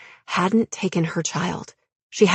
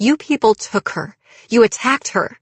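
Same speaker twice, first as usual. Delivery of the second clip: higher, louder, accusing; angry accusation; 5 semitones higher, 5 dB louder than usual. A narrator's reading. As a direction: throw the blame at him. Heroine accuses cult member.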